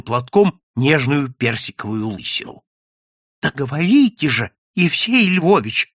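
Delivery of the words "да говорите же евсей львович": urgent, impatient plea; pleading. A narrator's reading. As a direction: beg him to speak, pitch lifted. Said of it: "взмолились сотрудники".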